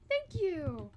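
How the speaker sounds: high, loud sing-out of gratitude; bright thanks. Real, not acted. Streamer thanking a viewer, high excited call.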